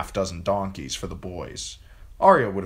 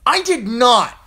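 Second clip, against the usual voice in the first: much higher, loud angry denial; shouted; 12 dB louder, slower than usual. A narrator's reading. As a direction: shout it to defend Jon. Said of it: "she shouted".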